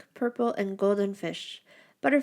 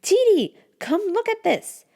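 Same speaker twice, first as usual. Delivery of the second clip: much higher and louder call; 13 semitones higher, 6 dB louder than usual. A narrator's reading. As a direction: shout to a friend in the back room. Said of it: "it calls out".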